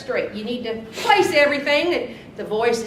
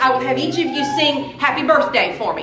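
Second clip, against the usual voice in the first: ordinary talk, nothing marked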